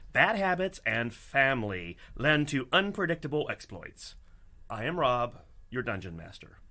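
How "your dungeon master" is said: usual speaking voice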